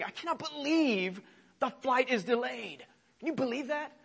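mock the petty outrage of a delayed flyer; whiny complaint. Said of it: "It's a very normative thing".

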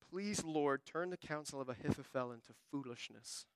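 low, hushed plea to God; understated desperation, voice sinking softly; desperate prayer. Acted. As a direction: pray it softly, trailing down. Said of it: "in his desperation".

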